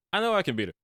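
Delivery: high-pitched, self-assured retold claim; confidently voiced quote, pitched well up. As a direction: imitate a kid sure she'll win.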